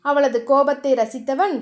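ordinary delivery

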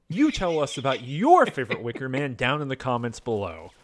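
loud, punchy YouTuber sign-off. Shown as a demonstration. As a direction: sell the comment plea like a YouTuber. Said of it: "let me get my, uh, YouTuber voice on".

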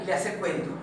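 ordinary delivery

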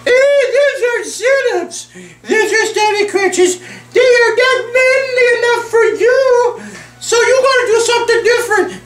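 squeaky mocking falsetto, laughing; mock a nagging critic in falsetto; mocking high voice. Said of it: "Then I hear this".

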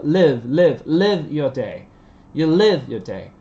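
LIVE repeated loudly with short, light i. Shown as a demonstration. Teacher drilling live your day, short light i in live. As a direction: drill "live" with a lax vowel. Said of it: "Live, âm y nhẹ".

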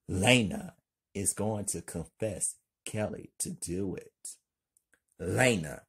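usual speaking voice

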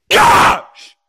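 harsh tantrum scream. Acted. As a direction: scream it in exasperation. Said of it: "stop screaming".

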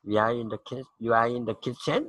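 statement turned question, "kitchen" swung upward; rising final tone. Shown as a demonstration. Teacher shows a statement becoming a question, "kitchen" rising at the end. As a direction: let the voice climb on the last syllable of "kitchen". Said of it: "เสียงสุดท้ายมันจะสูงขึ้น".

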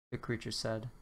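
plain reading voice

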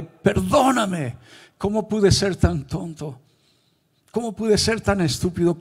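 loud, high-pitched cry of remorse; anguished crying out. Retold or imitated. Preacher's spirit begging God's forgiveness, anguished crying out. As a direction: shout the self-reproach at full force, pitch soaring. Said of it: "pero mi espíritu gritaba".

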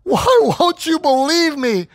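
put-on high, slow tearful plea of a wronged boy; crying child. Son begging dad to believe him, crying. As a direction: voice the son pleading through tears. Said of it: "He starts crying".

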